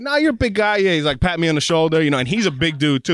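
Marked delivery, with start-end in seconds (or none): 0.0-0.8 breezy dentist. Retold or imitated. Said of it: "And he's like".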